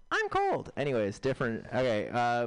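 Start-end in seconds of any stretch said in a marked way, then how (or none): 0.1-0.7 very high mock female voice; high-pitched little complaint; woman impression. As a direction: squeak it like a caricature woman. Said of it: "here's my impression of a woman in an Otezla commercial".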